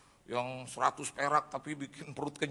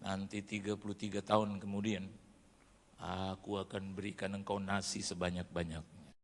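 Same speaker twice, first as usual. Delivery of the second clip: low, soft, measured promise; voice of God; 9 semitones lower, 6 dB quieter than usual. Retold or imitated. God promising rice to a suffering youth, low divine voice. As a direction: speak low and steady as God reassuring. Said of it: "Tuhan bilang".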